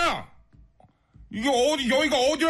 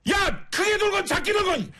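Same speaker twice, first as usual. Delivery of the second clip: hammed-up furious telling-off with sudden shouts; mock angry rant. On a listener's request, chewing out a rude male driver in a hammed-up angry rant. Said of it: "화 좀 내주세요".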